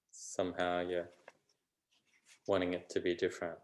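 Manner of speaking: unhurried meditation voice with long pauses; calm, even delivery, phrase by phrase; guided meditation. Real, not acted. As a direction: gently guide them inward, leaving space between phrases.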